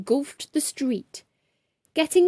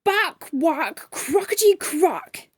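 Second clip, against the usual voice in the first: higher, slow angry exclamation; pompous gentleman fuming over his lunch; mild posh roar; 5 semitones higher, slower than usual. A narrator's reading. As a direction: splutter with outrage. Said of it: "he roared".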